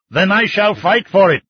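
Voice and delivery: high, loud fighting retort; defiant retort. Acted. Old man to the mining chief, loud defiant retort. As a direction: snap it back, ready to fight. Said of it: "he retorted".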